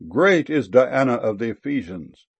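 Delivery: plain reading voice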